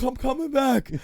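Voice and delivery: high, loud, drawn-out holler; exhausted shouted threat. Retold or imitated. Worn-out teen fighter calling to his rival, loud strained shout. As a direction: call back loudly while worn out.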